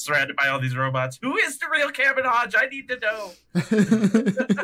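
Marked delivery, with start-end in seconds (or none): 1.2-4.6 high-pitched frantic demand; hammed-up identity crisis, breaking into laughter; mock desperation. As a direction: beg for the answer, high and comic. Acting out Hodge's identity crisis.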